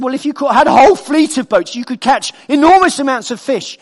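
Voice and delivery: high, loud mounting sales pitch; eager businessman. Retold or imitated. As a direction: act out his growing excitement at the big plan. Businessman's plan escalates in story.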